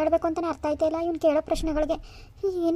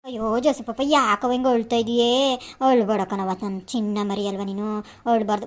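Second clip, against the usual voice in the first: low, slow, tender coaxing; soothing a child; 5 semitones lower than usual. Acted. Aunt comforts nephew crying for grandma, soothing. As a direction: hush a crying little one gently.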